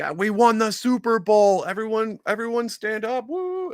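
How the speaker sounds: very high, loud goofy voice; squeaky excited announcement to the team; hammed-up falsetto player calling everyone up; mock proposing player. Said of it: "you're like".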